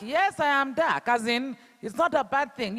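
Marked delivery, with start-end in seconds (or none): 0.0-1.1 higher, slow and deliberate acceptance; proud retort. Acted. Girl answering someone shaming her, proud retort. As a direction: own it proudly, word by word.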